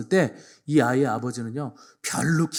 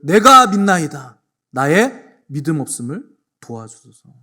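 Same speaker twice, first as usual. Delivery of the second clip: slow, much louder pleading outcry; desperate cry; 10 dB louder, slower than usual. Retold or imitated. Father crying out to Jesus, desperate loud plea. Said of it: "소리를 지르기를".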